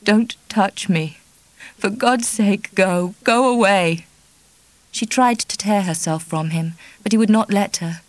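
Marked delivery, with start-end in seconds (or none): 0.0-4.1 slow, pleading and tearful; crying hysterically. Acted. Kitty pushing Charlie off, slow tearful plea. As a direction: beg through tears. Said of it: "She was crying hysterically now".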